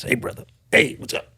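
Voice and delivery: husky, voiceless buddy greeting; Joe Carnahan. Acted. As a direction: imitate a friend's rough, barely voiced hello. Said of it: "You'll hear his voice".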